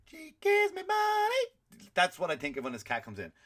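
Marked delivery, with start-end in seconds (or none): 0.1-1.9 very high, slow, put-on voice for a gold-digging cat; gold digger cat voice. Comic fantasy of the cat's voice, high and slow. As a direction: stretch it out like a squeaky scheming pet.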